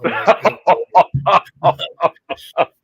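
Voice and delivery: loud burst of real laughter, breaking up; cracking up hard at the punchline. Real, not acted. Laughing at his own pillow joke, a loud real burst.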